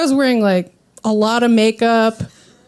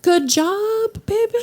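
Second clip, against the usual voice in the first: much higher, sweet, absent-minded praise; fake encouragement; 9 semitones higher, slower than usual. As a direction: pat him on the head with your voice. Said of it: "I'm like".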